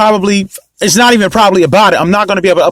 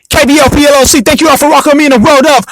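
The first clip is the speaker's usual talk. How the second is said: higher-pitched hype-man intro; hyped shout-out; 5 semitones higher than usual. Acted. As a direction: drag out the last words like an announcer. Hyped shout-out opening the show.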